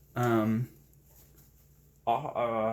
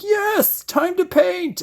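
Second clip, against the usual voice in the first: eager; 6 dB louder, slower than usual. Retold or imitated. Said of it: "I'm like".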